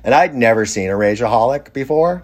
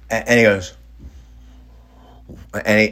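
ordinary delivery, nothing marked

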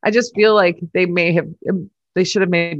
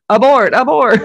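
louder, higher urgent command; mock urgent radio call; 6 semitones higher, 6 dB louder than usual. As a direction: call it off over the team radio. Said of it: "And then you're like".

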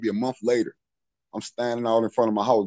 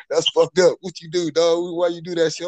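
higher-pitched mock scolding after a laugh; imitated amused reproach; 7 semitones higher than usual. Neighbor ribbing his friend outside, imitated amused reproach. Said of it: "He came outside laughing".

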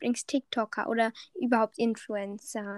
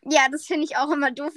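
ordinary talk

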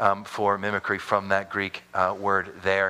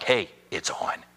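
mock challenge; 5 semitones lower, slower than usual. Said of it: "It was very passive, very aggressive".

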